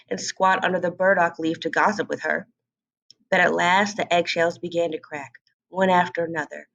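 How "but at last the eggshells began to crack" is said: ordinary delivery, nothing marked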